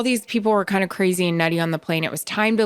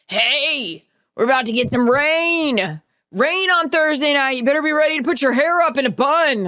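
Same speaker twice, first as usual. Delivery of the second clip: rowdy passenger; 7 semitones higher than usual. Retold or imitated. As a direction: imitate a fired-up, liquored-up fan. Acting out a rowdy fired-up passenger.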